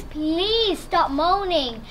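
ordinary talk

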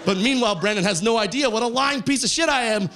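ordinary talk

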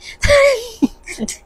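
a high, bright burst of real laughter; embarrassed laugh. Real, not acted. After the officers laughed at her answer, a high embarrassed laugh.

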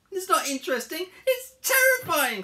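high, loud, mounting alarm; acted terror. Frightened Harold corrects his friend, shrill panic. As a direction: stretch and shriek the last word.

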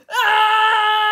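loud high startled scream. Acted. Boys screaming at the hairy ape. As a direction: shriek at the ape face. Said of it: "all screamed together".

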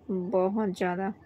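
ordinary talk

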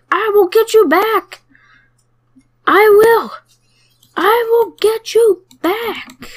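high, slow, stretched-out troll threat; angry troll. Pretend. Tiny eaten cell vows revenge, in a high squeaky troll voice. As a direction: vow revenge as a silly high-pitched troll. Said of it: "I'm such an angry troll".

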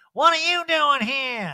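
put-on high, loud, drawn-out drunken yell; hammed-up drunk impression; mock drunk. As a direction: holler it slowly as if hammered. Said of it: "hammered, is like".

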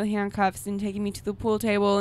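ordinary talk, nothing marked

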